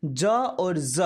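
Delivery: first "ज" as ja, second "ज" as za; j then z. Shown as a demonstration. Teacher summing up for learners, "ज" as ja, then "ज" as za. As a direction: contrast "ज" stopped j with "ज" buzzing z. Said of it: "का उच्चारण करते हैं".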